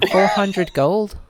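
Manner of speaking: incredulous. Acted. Incredulous repeat of price.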